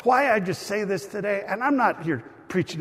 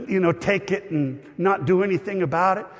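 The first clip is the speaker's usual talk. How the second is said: normal talking voice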